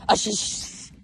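breathy hushed imitation of someone shushing; mock whisper. Mocking a teammate who whispered while hiding. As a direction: whisper the stuttered sh-sounds like a caught hider. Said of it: "did I just hear someone whisper".

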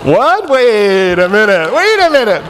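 high, drawn-out disbelief; incredulous outburst. Retold or imitated. Homeless pair hearing he directs homeless outreach, loudly incredulous. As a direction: stretch it out high in comic astonishment.

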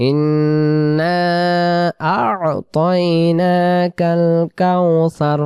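slow chanted recitation; drawn-out Quranic chant. Shown as a demonstration. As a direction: recite slowly and melodically. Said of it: "তারপরে শা".

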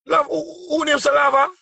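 high, insistent asking; excited high question. Real, not acted. Pressing a caller for a name, high and insistent.

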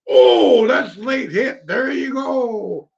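loud, high, drawn-out call; real excited sports-fan yell; shouting at a foul. Real, not acted. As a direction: holler at the late hit, stretching words. Reacting loudly to a late hit.

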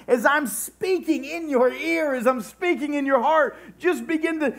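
high-pitched, insistent sermon climax; fervent preaching. Real, not acted. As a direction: preach God's urging in long, ringing phrases. Preaching climax voicing God's urging.

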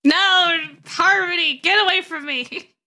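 high, loud cry of refusal; dramatic shout. Pretend. Streamer warding off Harmony, high dramatic shout.

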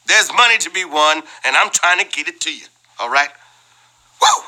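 usual speaking voice

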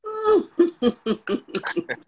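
long, high peal of laughter. Real, not acted. Sister cracks up at brother's story, high laughter.

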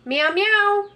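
high, sing-song meow; imitated cat meow. Checking if the toy cat still responds, imitated meow. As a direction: meow up high to prompt a pet.

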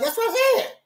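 wife insisting, mock high; high wife quote. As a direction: squeak it like the wife. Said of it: "she was like".

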